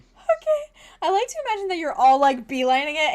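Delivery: giddy rush of delight; excited burst. Real, not acted. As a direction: gush with glee.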